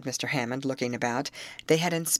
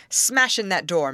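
firm, louder command; stern; 3 semitones higher, 5 dB louder than usual. A narrator's reading. Rescuer ordering his men to the locked shack, sternly. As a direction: order it done with authority. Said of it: "warned Mister Hammond sternly".

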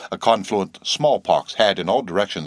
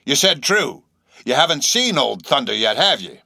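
raised seaman's voice; high, insistent sailor stranger; eccentric old sailor, intent; 7 semitones higher, 5 dB louder than usual. A narrator's reading. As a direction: press them with strange earnestness. Stranger questions them.

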